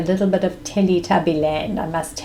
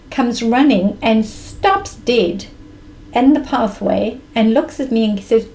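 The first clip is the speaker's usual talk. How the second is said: ordinary delivery, nothing marked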